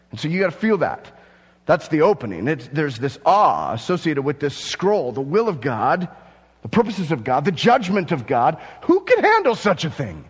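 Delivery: high, loud, fervent preaching; preaching at full force. Acted. Pastor stirs the church about the scroll, full-force preaching. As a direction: preach it big to the back row.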